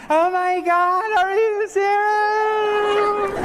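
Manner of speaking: overjoyed fiancée, hammed-up; imitated proposal reaction, shrieking high and slow; mock tearful gasp. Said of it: "most women start crying".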